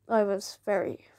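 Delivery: ordinary talk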